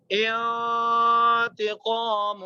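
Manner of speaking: انتقام with the نون hidden in a long nasal hum; slow Quranic انتقام with ghunna; nasal ikhfa. Shown as a demonstration. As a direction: stretch انتقام slowly, the نون sounding through the nose. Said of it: "آواز ناک میں جا رہی ہے".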